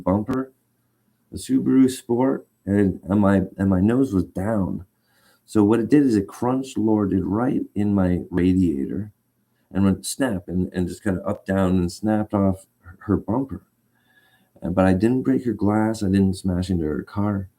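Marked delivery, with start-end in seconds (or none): none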